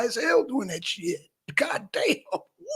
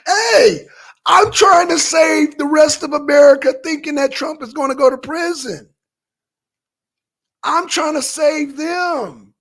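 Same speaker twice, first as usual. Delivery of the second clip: high, loud pleading cry; exasperated shout; 5 semitones higher, 8 dB louder than usual. Real, not acted. Trying to save America from false hope, shouting in exasperation. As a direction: plead with the whole country at full voice. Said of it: "pleading with viewers".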